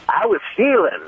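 high-pitched sidekick's comic confession; Harry Goins. Retold or imitated. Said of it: "Harry Goins".

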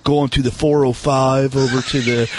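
mock SNL-style Californian; Californian skit accent. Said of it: "They do that skit on Saturday Night Live".

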